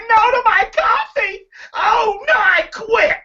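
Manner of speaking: high, hammed-up wail; mock devastation. Coach playing waitress told no to coffee, mock devastation. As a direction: wail slowly as if fake-crying.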